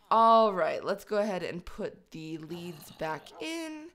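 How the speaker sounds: ordinary delivery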